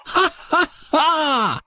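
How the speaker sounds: high jeering ha-ha; mocking laugh. Chickens laugh at Daisy crashing, jeering. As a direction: cackle at her fall. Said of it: "laughed out loud".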